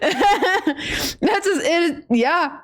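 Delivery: real giggling talk; laughing through words. Real, not acted. She giggles at her own joke while talking.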